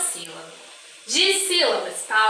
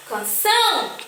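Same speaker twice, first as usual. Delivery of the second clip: "canção" with a strong final ÇÃO; stress on last syllable; 5 semitones higher than usual. Shown as a demonstration. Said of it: "qual é a sílaba mais forte?".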